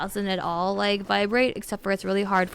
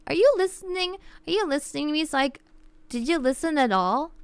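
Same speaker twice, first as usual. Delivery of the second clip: exasperated; 6 semitones higher, faster than usual. Real, not acted. Scolding chat for not listening.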